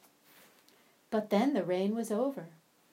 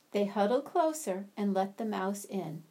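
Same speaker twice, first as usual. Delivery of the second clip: normal talking voice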